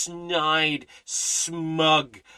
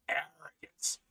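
whispered word; 10 dB quieter than usual. Real, not acted. Preacher whispers arrogance to his congregation, whispered. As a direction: breathe arrogance, unvoiced.

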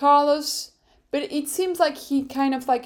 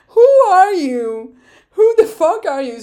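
mock outrage; 7 semitones higher, 11 dB louder than usual. To Lance over his parking lot threat, a loud incredulous shout. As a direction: shout it in disbelief, half laughing.

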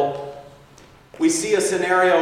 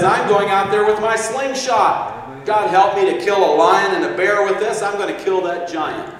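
put-on eager young vow; 5 semitones higher, 4 dB louder than usual. David vows to fight Goliath.

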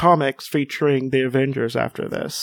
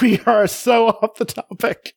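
laughing exclaim; 7 semitones higher than usual. Real, not acted.

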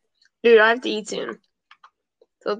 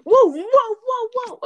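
startled high cry; 13 semitones higher, 4 dB louder, slower than usual. Real, not acted.

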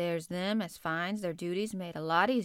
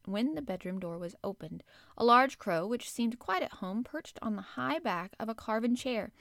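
ordinary delivery

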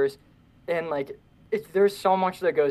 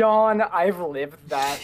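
bored; 3 semitones higher, 4 dB louder, much slower than usual. Retold or imitated. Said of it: "And you're like".

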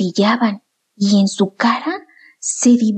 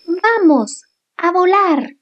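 mother owl voice; 8 semitones higher, slower than usual. Acted. Mother owl calls her chicks.

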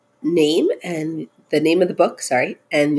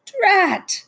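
high-pitched exclamation of frustration; dismayed; 14 semitones higher than usual. Acted. Toad surfacing in the pond, dismayed. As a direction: blurt it out, pitched way up. Toad upset at losing ice cream.

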